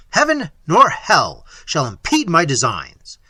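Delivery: loud, defiant vow; Manfred defying heaven itself. Acted.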